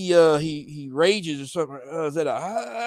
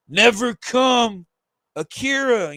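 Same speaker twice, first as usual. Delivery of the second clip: calling out; 6 semitones higher, 5 dB louder, slower than usual. Acted. Mother calls after Akira.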